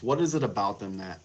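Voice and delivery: ordinary talk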